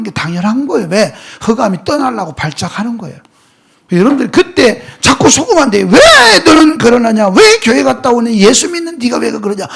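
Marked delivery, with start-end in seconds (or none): 5.9-9.7 acted scolding. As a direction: voice an accuser's exasperated questioning. Voicing people scolding a believer.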